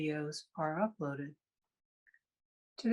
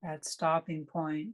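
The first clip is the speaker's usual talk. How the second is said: guided meditation. Real, not acted. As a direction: guide them through breath awareness, leaving long silences between phrases.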